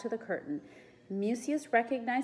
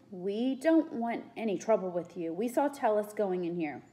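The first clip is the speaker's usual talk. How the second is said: ordinary delivery, nothing marked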